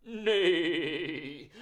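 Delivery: whinnying like a horse; horse neigh. Shown as a demonstration. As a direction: neigh like a pony. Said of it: "What sound does a horse make?".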